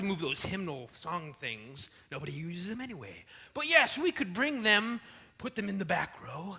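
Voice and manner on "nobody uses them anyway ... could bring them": normal talking voice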